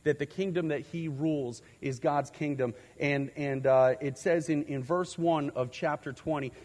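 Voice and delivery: ordinary talk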